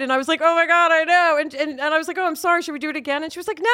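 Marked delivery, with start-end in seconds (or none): 0.4-1.4 higher-pitched retold mortification; mortified reaction. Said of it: "I could have crumpled or died". 2.2-3.2 quick, higher retold apology; mortified apology. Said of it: "I could have crumpled or died".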